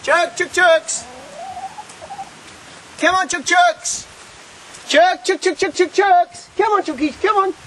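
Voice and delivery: high sing-song call to the hens; calling chickens. Real, not acted. Calling his chickens to come.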